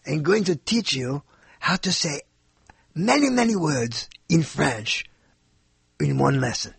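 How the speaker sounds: mock French accent, word by word; stupid French accent. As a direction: imitate a Frenchwoman, deliberately silly French accent. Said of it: "this stupid French accent".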